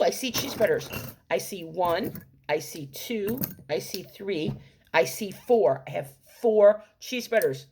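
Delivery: ordinary delivery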